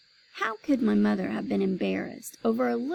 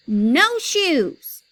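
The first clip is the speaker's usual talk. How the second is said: loud refusal; 6 semitones higher, 8 dB louder than usual. A narrator's reading. Child refusing new shoes.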